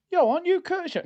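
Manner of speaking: high-pitched acted stranger; excited recognition. Gym stranger spots the streamer, high excited recognition. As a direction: call it out high, as if spotting someone known.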